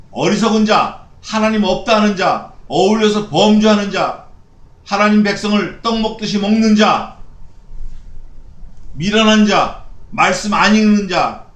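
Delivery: fiery preaching. Real, not acted. Pastor listing the wicked to his congregation, fiery preaching. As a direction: preach it out like a condemnation.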